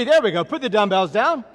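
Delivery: loud, high call to the class; exercise command. Real, not acted. Trainer launching side straddle hops, loud command. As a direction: announce the drill with force. Instructor calls out next exercise.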